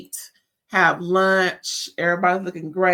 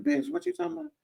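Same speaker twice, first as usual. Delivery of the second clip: higher, softer, mock-incredulous voice; incredulous look; 9 semitones higher, 8 dB quieter, much faster than usual. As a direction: voice their disbelieving stares. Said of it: "They're looking at her like".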